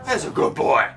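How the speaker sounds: high, sing-song coaxing of an animal; high pet talk to a good boy. Acted. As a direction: sweet-talk the bull like a dog. Coaxing the game bull.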